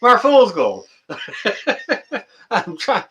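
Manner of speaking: high voice breaking into giggles; real laughing. Real, not acted. Streamer trying to thank a donor, laughing helplessly. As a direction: struggle to get the words out through laughter. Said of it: "You got me laughing here".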